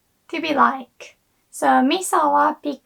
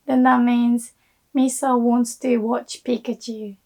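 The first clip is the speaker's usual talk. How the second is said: normal talking voice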